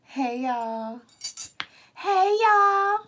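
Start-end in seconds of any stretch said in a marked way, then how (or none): 1.9-3.0 high-pitched, drawn-out greeting; TikTok mukbang girl. Retold or imitated. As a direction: impersonate a mukbang creator's squealed hello. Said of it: "what's that girl name on TikTok".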